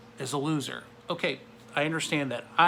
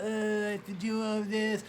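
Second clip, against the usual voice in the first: mock whine, slow and a little higher; whiny complaint; 6 semitones higher, 3 dB quieter than usual. Mocking the Israelites, a lightly whiny slow voice. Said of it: "whine and moan and complain".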